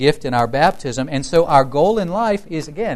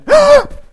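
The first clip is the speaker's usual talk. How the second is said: loud, high theatrical gasp; horrified gasp; 13 dB louder than usual. Retold or imitated. Mom reacts to pupil's complaint, exaggerated horrified gasp. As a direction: suck in air in mock horror. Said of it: "looked horrified and she went".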